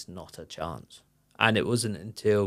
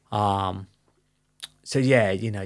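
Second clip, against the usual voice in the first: ordinary talk, nothing marked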